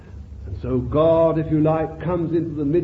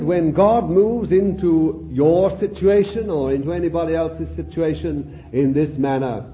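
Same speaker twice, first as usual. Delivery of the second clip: ordinary delivery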